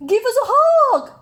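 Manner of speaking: high, loud eager cry; eager call. Acted. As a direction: call out hoping for a hug. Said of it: "cried Douglas".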